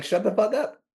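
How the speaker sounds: high, cranky retort; mock snapping voice. His tired voice talking back, high and cranky. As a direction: let the worn voice snap back. Voice telling him off.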